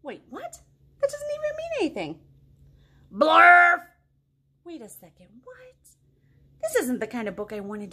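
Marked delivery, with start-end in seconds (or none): 0.0-2.2 mock confusion. Reader pretends dismay. 3.1-3.8 a sudden loud "Blurf!"; loud silly shout. Acted. Reading to kids, a loud shouted nonsense word. 4.6-5.8 mock confusion. Reader pretends dismay.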